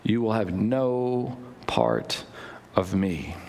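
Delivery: Jesus answering. Acted. Jesus answering Peter, low and slow. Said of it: "Jesus answered".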